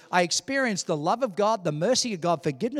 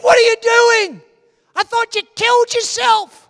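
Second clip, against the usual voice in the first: high, loud exasperated demand; re-enacted frantic scolding; alarmed shouting; 14 dB louder than usual. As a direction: confront him at a shout, acting the panicked brother. Alarmed brother confronts the praying speaker.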